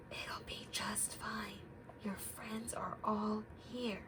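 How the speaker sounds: soft reassuring whisper. Acted. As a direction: whisper comfort into Bear's ear. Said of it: "He whispers in his ear".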